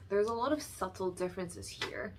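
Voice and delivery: ordinary talk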